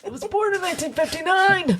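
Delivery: hammed-up high squawk about a birth year; silly put-on voice. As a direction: put on a high, quick comic voice. Silly repeat of birth-year joke.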